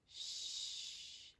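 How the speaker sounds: soft, long shushing sound; hushing shh. Acted. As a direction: hush them with a gentle shh. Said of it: "The girl is going".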